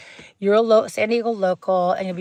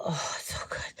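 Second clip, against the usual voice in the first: hushed, whispery swoon; savouring; 11 dB quieter than usual. Real, not acted. Vlogger recalling Julian pie gelato, savouring in a whisper. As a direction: drop to a whisper in delight.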